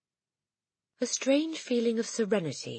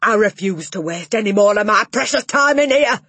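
loud, higher scornful roar; roaring dismissal; 6 semitones higher, 13 dB louder than usual. Acted. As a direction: storm off with a final roar. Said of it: "roared the Trunchbull".

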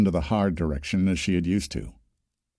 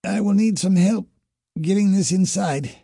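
high, strained croaky request; hoarse croak; 9 semitones higher, 3 dB louder than usual. A narrator's reading. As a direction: croak it out through a worn-out voice. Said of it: "Her voice was hoarse".

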